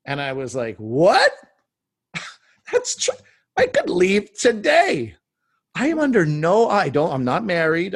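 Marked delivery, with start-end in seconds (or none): 0.8-1.3 loud, high-pitched what; retold stunned reaction; astonished. Sudden realization acted out in story. 2.7-7.9 high squeaky elation; like a prisoner finding the door open; hammed-up giddy disbelief, louder; thrilled realization. Realizing he could leave, acted out.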